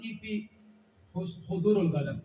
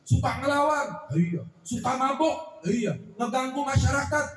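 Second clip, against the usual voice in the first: stern interrogation; 4 semitones higher, 5 dB louder than usual. Acted. Umar interrogates the rebellious son.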